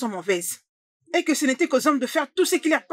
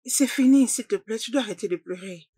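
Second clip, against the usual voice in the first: tender, lowered voice with long pauses; gentle consoling; 2 semitones lower than usual. Acted. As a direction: comfort someone who is crying. Consoling a crying friend.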